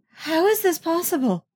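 high, incredulous protest. A narrator's reading. Deepa challenging the impossible news, protesting. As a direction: push the pitch up in disbelief. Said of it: "Deepa protests".

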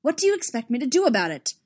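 angry admiral, fast and loud; angry retort. Acted. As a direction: fire it back, fast and furious. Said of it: "Emil snapped".

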